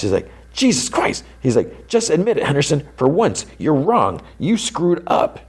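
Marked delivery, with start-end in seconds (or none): 0.5-1.2 high, strained mock yell without much volume; retold yell. Steve losing it at Dustin, retold as a mock yell. 1.9-5.4 high-pitched mock rant, only slightly raised volume; retold angry yelling. Steve demanding Henderson admit he's wrong, mock yelling.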